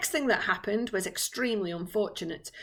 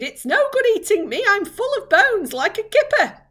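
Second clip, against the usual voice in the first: shouted; 12 semitones higher, 9 dB louder than usual. Acted. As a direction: shout it, pushing the blame away. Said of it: "shouted the centipede".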